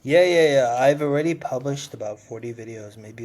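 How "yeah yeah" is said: ordinary talk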